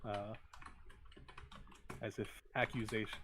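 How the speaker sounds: ordinary delivery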